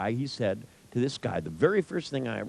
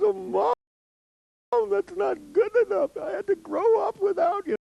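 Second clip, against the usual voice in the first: very high, wailing imitation of a sobbing man; mock crying; 3 dB louder than usual. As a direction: ham up a son bawling at his mother. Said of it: "starts crying".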